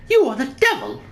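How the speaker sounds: high, loud accusing lady's voice; high-pitched Pavlova. Acted. Pavlova denouncing the intruder, high-pitched and loud. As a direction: fling it at him in a high put-on voice. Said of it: "the really high-pitched voice".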